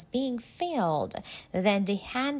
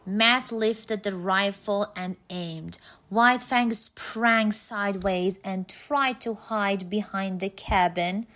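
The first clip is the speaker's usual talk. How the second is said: usual speaking voice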